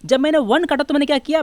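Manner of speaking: normal talking voice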